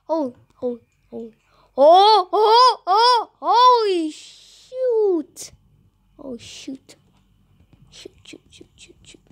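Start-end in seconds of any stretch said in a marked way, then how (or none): 1.8-5.5 loud, high, drawn-out frightened oh; panicked cry. Real, not acted. Gamer panicking as a creature appears, crying out loud. 6.2-9.2 hushed, breathless whisper; frightened whisper. Real, not acted. Gamer hiding from the creature, whispering in fear.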